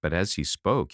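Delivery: ordinary delivery, nothing marked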